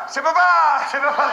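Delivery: put-on high, loud child answer; toddler voice. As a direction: chant it like a toddler.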